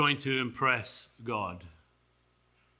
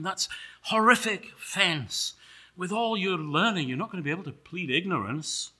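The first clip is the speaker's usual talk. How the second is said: higher, hushed-horror warning; alarmed angel; 6 semitones higher than usual. Acted. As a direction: spell out the awful charge, pitched high. Angel warns of Paul's guilt.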